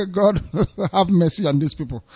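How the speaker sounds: softly, through real helpless laughter; laughing. Real, not acted. Host laughing at news of Igbos in Lagos, laughing helplessly. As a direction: let laughter break into the words, voice dropping. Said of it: "I shouldn't be laughing".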